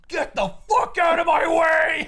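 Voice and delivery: slow, high, full-throated shout; Batman roaring. Retold or imitated. Batman bellows at the fleeing goons, a slow loud roar. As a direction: roar them off like a cornered beast. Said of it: "rears up and goes".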